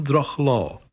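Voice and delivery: droch law with both words fully stressed; two primary stresses. Shown as a demonstration. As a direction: give droch and law equal strong stress. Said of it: "primary stress followed by another primary stress".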